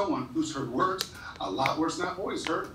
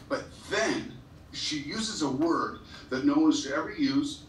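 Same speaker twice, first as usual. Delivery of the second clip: ordinary talk, nothing marked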